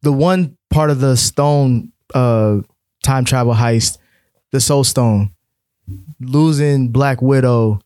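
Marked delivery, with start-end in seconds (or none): none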